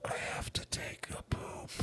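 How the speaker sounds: hushed, unvoiced secret; very soft whispered confession; whispering. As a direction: whisper it as if into someone's ear. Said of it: "What is he whispering?".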